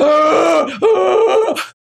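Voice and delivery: loud, high whooping gasps; mock choking gasp. Pilot re-enacting forced oxygen, mock choking gasp. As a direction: act out air being forced into the mouth. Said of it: "I was going".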